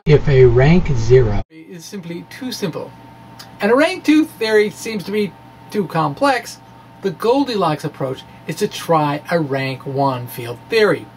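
ordinary talk

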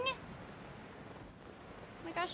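ordinary delivery

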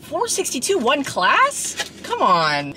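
high disbelieving squeal; incredulous. Retold or imitated. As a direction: act outraged at the number. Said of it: "I oftentimes am like".

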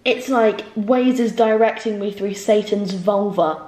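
normal talking voice

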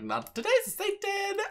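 sassy taunt. Retold or imitated. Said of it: "I was just like".

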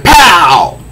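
punchy comic-book pow. Acted. Narrator punching out the sound effect, high and sharp. Reading comic fight aloud.

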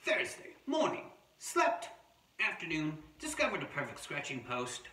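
amateur Australian diary entries, high at the start; attempted Australian accent. Adult reading a wombat's diary to children, makeshift Australian accent. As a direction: announce the day in playful pretend Australian. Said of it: "not sure if this is a real Australian voice".

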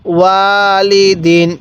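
ওয়ালিদিন very slow, vowels held level; recitation model. Shown as a demonstration. Teacher showing the learner how to read, drawn-out ওয়ালিদিন. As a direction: chant ওয়ালিদিন with a long first syllable. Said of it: "কিভাবে শিখব".

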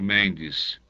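plain reading voice

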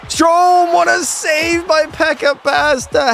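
high, slow cry of disbelief; amazed shout. Real, not acted. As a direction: stretch it out, stunned by the save. Amazing save, player yells in awe.

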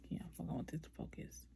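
quick whispered aside. Real, not acted. Muttering to herself while the camera refocuses, whispered. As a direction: breathe it under your voice to yourself.

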